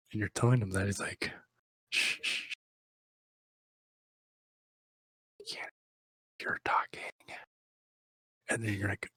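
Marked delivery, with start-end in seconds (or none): 1.9-7.1 whispered shushing, softer; a soft whispered shh, shh; hushing whisper. Retold or imitated. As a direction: hush them in a breathy whisper. Jackson hushing the listener.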